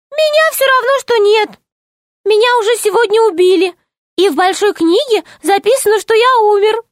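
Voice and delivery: high, cheeky little gnome; amused gnome. Acted. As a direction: joke like a tiny mischievous gnome. Gnome jokes. (весёлый голос гнома)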